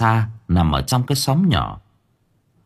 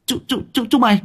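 very high, stammering panic; acted fright in a high pitch; trembling with fear; faster than usual. A narrator's reading. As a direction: stutter like a terrified captive. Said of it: "run cầm cập".

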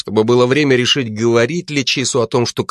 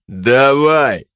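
barked order, a bit louder; sharp shout; 3 semitones higher, 3 dB louder than usual. A narrator's reading. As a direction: cut him off with a loud command. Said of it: "прикрикнул Чиз". (резкий окрик)

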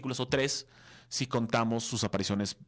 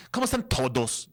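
"¿Cómo están todos?" in a Yucatec accent, blunt and louder; 2 semitones higher, 4 dB louder than usual. Shown as a demonstration. Host shows viewers the Yucatec accent he slips into, louder and punchy. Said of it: "En vez de decir".

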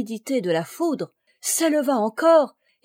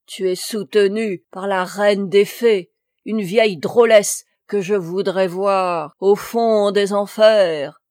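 loud raging curse, slowing at end; furious cry; 7 dB louder than usual. A narrator's reading. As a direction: hurl the curse in rage. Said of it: "elle s'écria". (s'écrier avec fureur)

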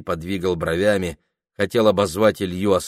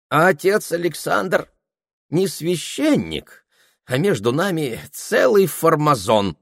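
fawning peasant voice; 9 semitones higher, 3 dB louder than usual. Acted. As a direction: play it as a wheedling coachman. Ilya grumbles about the priest.

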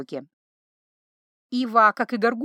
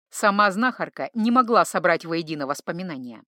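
usual speaking voice

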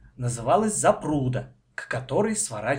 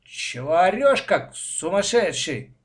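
drawn-out, scolding grumble; slow, annoyed child's complaint; grumbling; 5 semitones higher, 3 dB louder, slower than usual. A narrator's reading. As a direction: tick him off crossly. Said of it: "проворчала на Колю Маша".

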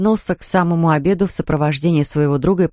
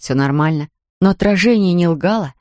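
plain reading voice

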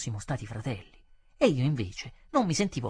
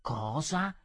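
understated questioning disbelief; almost incredulous; 4 dB quieter than usual. A narrator's reading. Customer doubting what he heard, almost incredulous. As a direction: ask it with mild incredulity. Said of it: "quasi incredulo".

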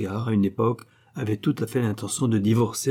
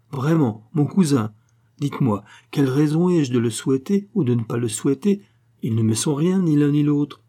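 louder, higher, indignant denial; heated retort; 5 semitones higher, 5 dB louder than usual. A narrator's reading. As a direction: push back hard and hot. Harry heated at Lady Clavering.

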